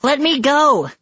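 high, loud plea; shrieky, high-pitched demand for release; desperate cry. A narrator's reading. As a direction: cry out as a captive girl. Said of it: "Scarlet cried".

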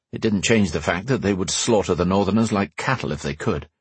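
ordinary delivery, nothing marked

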